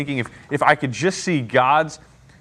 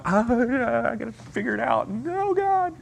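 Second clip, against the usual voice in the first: much higher, whiny self-imitation; mock panic; 9 semitones higher, 4 dB quieter, slower than usual. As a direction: play his own flustered protest. Speaker mocking his own panic.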